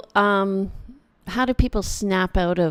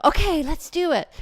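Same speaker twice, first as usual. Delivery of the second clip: much higher, louder eager reply; excited agreement; 10 semitones higher, 6 dB louder than usual. Retold or imitated. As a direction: imitate clients catching the excitement. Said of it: "they hear the excitement in my voice".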